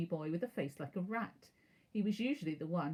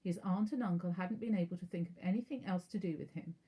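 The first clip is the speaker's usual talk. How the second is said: plain reading voice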